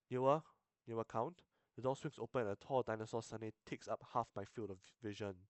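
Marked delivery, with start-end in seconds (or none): none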